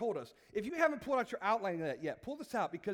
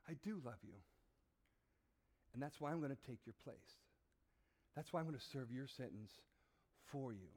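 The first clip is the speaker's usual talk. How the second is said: low, soft and sincere; tender fatherly declaration; 8 semitones lower, 11 dB quieter than usual. Acted. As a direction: say it gently, with weight and pauses. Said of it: "robe and says".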